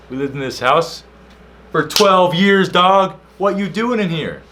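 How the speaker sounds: loud, high-pitched indignant protest; hammed-up outraged shouting; acted indignation. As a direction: build to a high, loud, incredulous yell. Indignant retort to the intruder, acted out.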